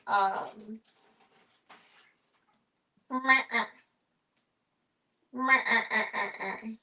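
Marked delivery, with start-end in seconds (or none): 3.1-6.7 wavering bleat, meh-eh-eh; bleating like a goat. Pretend. Imitating the cows on the show.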